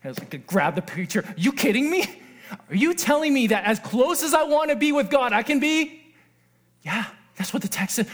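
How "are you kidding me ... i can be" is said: high-pitched, incredulous outburst; excited disbelief. Retold or imitated. Preacher reliving his discovery of the verse, excited disbelief. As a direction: blurt it high, losing your mind with amazement. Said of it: "I lost my mind".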